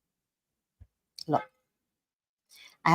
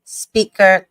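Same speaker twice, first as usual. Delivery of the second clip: usual speaking voice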